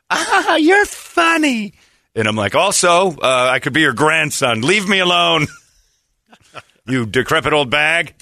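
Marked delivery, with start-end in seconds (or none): 0.1-1.7 very high, slow put-on lady voice; mock flirty lady. Lady flirting outside the bathroom, mock flirty. 2.6-8.1 higher-pitched, mildly exasperated retort; mock indignant brush-off. Man rejecting older lady's advances, mock indignant. Retold rejection of flirting lady.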